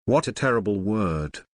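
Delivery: ordinary delivery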